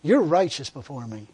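low, steady inner voice; voice of God. Retold or imitated. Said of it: "This voice says to me".

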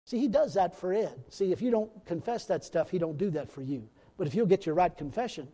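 usual speaking voice

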